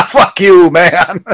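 mock-insult with a laugh in it; friendly joshing. Shown as a demonstration. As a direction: toss it out as a laughing jibe between friends. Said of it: "That wasn't like a friendly".

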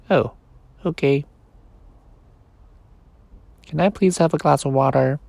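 a tiny voice begging not to be left; small toy voice. Acted. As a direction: toy bear begging the boy, small pleading voice. Sleepy's voice.

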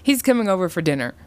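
quick, high, cheerful announcement. A narrator's reading. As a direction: rattle off the good news happily. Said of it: "Sam cheerfully answered".

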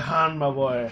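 put-on loud, jovial Irish accent. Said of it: "he had an Irish accent".

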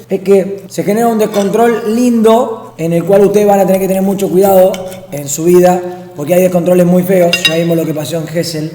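sermón serio y más fuerte a los pibes; consejo solemne a los chicos. Acted. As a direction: aconsejar con tono de padre, bien firme. Lecturing youngsters on partying. (hablando a los pibes de veinte, tono de sermón fuerte)

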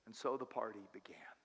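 soft unvoiced whisper. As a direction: breathe the ending as a secret. Whispered closing line.

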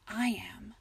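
whispered reassurance; reassuring husband whispering; tender whisper. A narrator's reading. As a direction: breathe it softly into her ear. Husband comforts crying wife.

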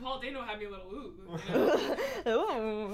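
drawn-out hammed-up ooh; mock fearful ooh. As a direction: stretch the ooh in mock fear. Acting out being scared.

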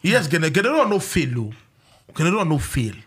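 ordinary talk, nothing marked

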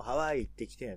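loud Kansai-dialect line, ワ of ハワイ prominent; 関西弁. Shown as a demonstration. Comparing accents for co-host, demonstrating Kansai accent. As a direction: stress ワ in ハワイ, Kansai accent, falling end. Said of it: "関西弁でも".

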